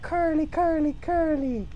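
tiny high-pitched squeaky chant; silly little high voice; caricature voice. Acted. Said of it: "And then he's like".

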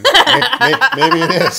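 high, loud laughing stutter; real laughter. Real, not acted. Doctor doubts his own pronunciation, laughing hard. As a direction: crack up while trying to get it out.

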